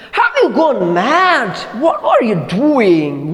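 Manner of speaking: high, slow, shocked cry; alarmed shout. Acted. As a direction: stop her in horror. Husband alarmed at fire.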